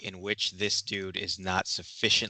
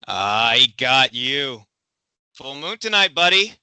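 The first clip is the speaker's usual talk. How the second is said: loud taunt; 2 semitones higher, 9 dB louder than usual. Acted. Twang voiced by the GM.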